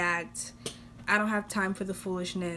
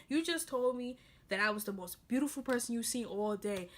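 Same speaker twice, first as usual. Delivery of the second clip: ordinary talk, nothing marked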